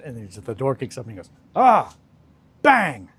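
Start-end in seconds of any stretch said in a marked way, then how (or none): 1.6-3.1 sudden high, loud "Ah, bang" like a movie shooter; mock bang. Retelling a Sacha Baron Cohen scene, loud high mock shout. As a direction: blurt it out as the gunman bursts in.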